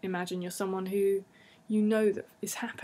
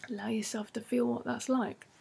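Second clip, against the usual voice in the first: whispered guidance; 3 semitones higher than usual. Acted. Guide whispers listeners into feeling good.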